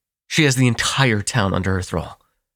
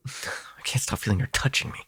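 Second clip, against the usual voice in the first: shaken whisper; 3 semitones higher, 7 dB quieter than usual. Acted. Disgusted, shaken confession.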